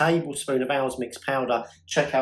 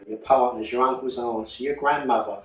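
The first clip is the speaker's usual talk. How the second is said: normal talking voice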